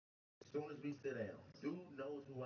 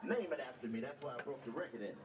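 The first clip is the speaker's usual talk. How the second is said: boasting OG; 5 semitones higher, 6 dB louder than usual. Retold or imitated. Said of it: "He like".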